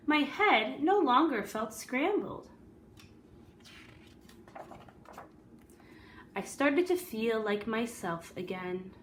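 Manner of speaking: lively read-aloud storytelling voice; story narration. A narrator's reading. Teacher reading a picture book to the class, storyteller voice.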